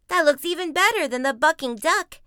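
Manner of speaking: high, loud cub voice; excited boy shout. Acted. Brother comparing rides, high excited shout. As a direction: gush loudly like a thrilled kid. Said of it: "shouted Brother".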